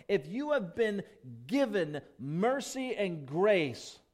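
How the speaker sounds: high, loud, fervent preaching; preaching at full force. Acted. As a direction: swell into passionate preaching. Preaching climax.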